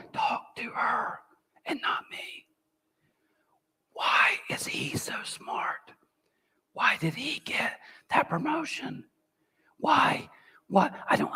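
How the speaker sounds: high, soft envious whisper. Retold or imitated. Pastor voicing the whispering comparison voice, high whisper. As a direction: hiss out the jealous thoughts, high. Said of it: "it just whispers".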